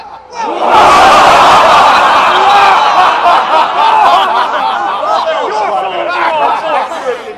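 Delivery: loud maniacal cackle; mad scientist laugh. Acted. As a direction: cackle wildly, villain style. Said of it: "laugh like a mad scientist".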